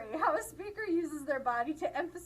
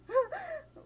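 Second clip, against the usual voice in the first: high, brief imitated whimper; mock whimper; 5 dB louder than usual. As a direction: mimic a flustered speaker's little whimper. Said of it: "the whimper really got to me".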